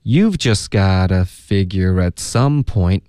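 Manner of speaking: slow, linked teacher's read-out; slow practice model. Shown as a demonstration. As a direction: model it slowly for learners to copy. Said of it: "大家要慢慢的练习".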